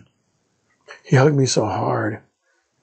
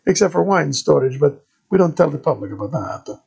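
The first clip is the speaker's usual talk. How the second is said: put-on high, loud thick Italian accent of a friar; Italian-accented padre greeting a stranger; 8 semitones higher, 3 dB louder than usual. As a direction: play the monk in a heavy Italian accent. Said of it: "the thickest Italian accent I'd ever heard".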